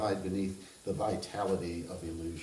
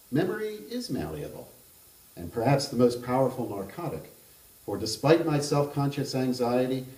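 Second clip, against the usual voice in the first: measured reading of a literary novel aloud; even, unhurried narrator voice; story narration; 3 semitones higher, 4 dB louder than usual. A narrator's reading. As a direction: read it like an author at a book event.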